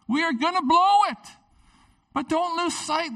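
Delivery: high, urgent preaching voice; full-force preaching. Acted. Pastor urges the congregation to start again, preaching high.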